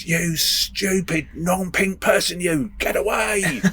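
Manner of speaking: high, slow, drawn-out mock sergeant yell; shouting drill sergeant. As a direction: imitate a drill sergeant's high-pitched holler, stretching each word. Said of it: "the drill sergeant shouted at him".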